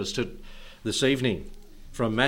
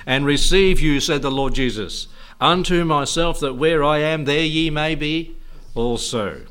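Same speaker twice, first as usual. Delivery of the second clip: loud, raised preacher's recital; scripture proclamation; 2 semitones higher, 6 dB louder than usual. Acted. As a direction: ring it out to the congregation.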